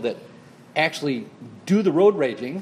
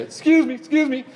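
high polite excuse me, excuse me; polite horn setting; 9 semitones higher, 5 dB louder than usual. Retold or imitated. Horn setting to say excuse me, high and polite. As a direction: chirp it like a courteous horn. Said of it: "I think you need a".

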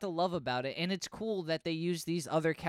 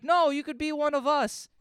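high, louder put-on voice; fake Thor; 11 semitones higher, 5 dB louder than usual. Thor impersonator inviting Wolverine to join, high hammed-up plea. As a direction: butt in with a hammed-up offer.

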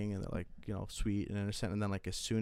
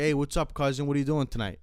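higher, louder mock tough-guy greeting; 5 semitones higher, 5 dB louder than usual. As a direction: friend copying his Italian style, lightly. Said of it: "he'll say shit like".

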